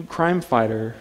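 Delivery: ordinary talk, nothing marked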